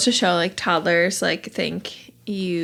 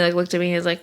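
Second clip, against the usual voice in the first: ordinary talk, nothing marked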